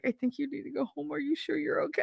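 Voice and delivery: high, soft, careful retold questioning; gentle concern. Principal checking on his crying teacher friend, gentle concern. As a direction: tiptoe softly round someone upset.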